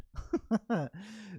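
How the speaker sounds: short real chuckle; brief amused laugh. Real, not acted. Teacher amused by own quip on multitasking, laughing.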